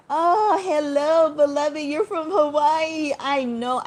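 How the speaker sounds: light up at a familiar name; excited greeting. Real, not acted. Welcoming a chat viewer from Hawaii with a high, bright hello.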